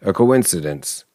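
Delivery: usual speaking voice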